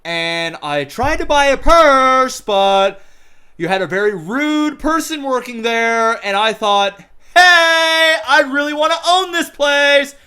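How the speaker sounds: high, loud, slow put-on Oprah voice; hammed-up celebrity boasting; mock Oprah. Oprah telling the shop owner she wants his store, hammed up. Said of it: "Oprah goes".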